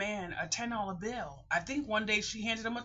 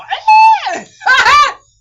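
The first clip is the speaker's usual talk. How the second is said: loud laugh; 25 dB louder than usual. Real, not acted. Joking about arguing with the driver, loud laughing burst. Laughs at her own remark.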